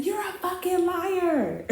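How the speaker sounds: high, drawn-out singsong accusation; mock accusation. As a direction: stretch the accusation out high without shouting. Acted accusation at the man.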